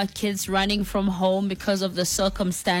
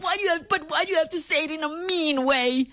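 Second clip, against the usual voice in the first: put-on whiny child; 8 semitones higher than usual. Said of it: "so it's like".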